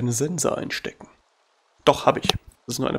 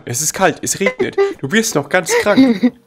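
mock worried mom; 7 semitones higher, 5 dB louder than usual. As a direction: fuss like a worried mother. Mock worried parent scolding.

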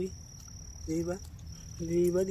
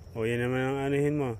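ordinary delivery

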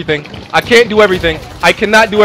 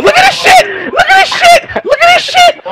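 high wailing outrage; pitched-way-up crying shout; anguished cry. Real, not acted. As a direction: wail it like a wounded fan. Ranked down, raging.

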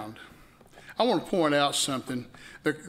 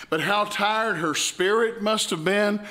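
usual speaking voice

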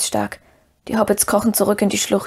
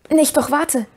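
higher-pitched call upward; firmer call; 6 semitones higher, slower than usual. A narrator's reading. Frodo calls up to Sam, in a firmer voice. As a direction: call up to stop him. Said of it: "rief Frodo hinauf, nur mit festerer Stimme".

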